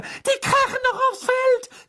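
very high, loud alarmed shriek; screech. A narrator's reading. Hermine sees both seekers plunging, panicked screech. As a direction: screech it at the top of the voice. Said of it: "kreischte Hermine".

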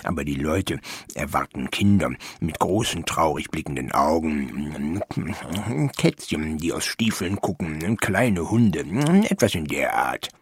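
low, halting gnome voice; skeptical gnome. Acted. Gnome advising the painter.

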